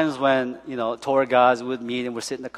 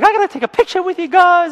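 much higher, louder and eager; excited driver; 5 dB louder than usual. Retold or imitated. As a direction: beg for a photo like a starstruck fan. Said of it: "He's like".

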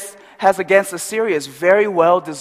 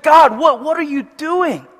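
higher, louder indignant cry at God; hammed-up prophet protesting to God; mock angry outburst; 8 semitones higher, 3 dB louder than usual. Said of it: "He gets so angry".

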